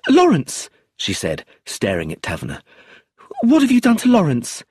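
high, loud frightened cry; alarmed cry. A narrator's reading. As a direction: call out for her lover in alarm. Arrested widow cries out.

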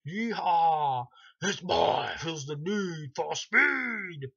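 loud, high cowboy whoop with drawn-out words; Freddy. Retold or imitated. Freddy crowing at Dan from the bike, whooping mock yell. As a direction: holler it at full blast, stretching the last word. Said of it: "looking at him going".

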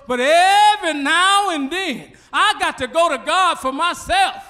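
emphatic preaching. Real, not acted. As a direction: drive home the point to the church.

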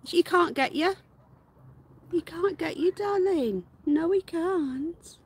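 high soothing pet voice, last words slow. Real, not acted. Owner soothing her scared rabbit in high, slow baby talk. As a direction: promise the bunny she's safe.

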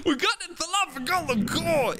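high, elated cry of success; thrilled triumph. Real, not acted. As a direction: announce the prize in an excited squeal. Gets the prize after winning.

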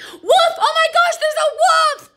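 high, loud frantic shout of wolf; panicked cry. Acted. Boy fakes alarm to skip his bath, high frantic yell. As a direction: yell it like the wolf is right there. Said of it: "He would just say".

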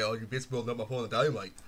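very low, soft rapper impression; E forty. Showing off a rapper imitation, very low voice. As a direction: drop it right down, like the rapper. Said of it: "I actually imitated E forty better".

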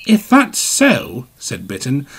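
high engine voice. A narrator's reading. Bitten challenges Gordon.

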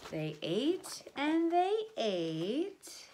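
very slow savouring refrain; slow drawn out. Acted. Storyteller drags out the townspeople's eating, very slow. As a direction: stretch each word.

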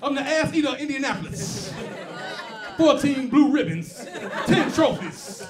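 loud, slow and proud, savoring every word; proud slow boast. Retold or imitated. Guy at a comedy show boasting loudly to the comedian, proud and slow. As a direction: brag like a champion.

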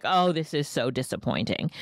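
low, let-down example voice; mock disappointed sigh of a line; demonstrated disappointment. Shown as a demonstration. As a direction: drop the pitch as if disappointed. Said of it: "an example as I'm talking".

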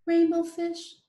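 small high fish voice, calling; little fish calling. Acted. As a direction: call after him in a tiny high voice. Little blue fish calls after him.

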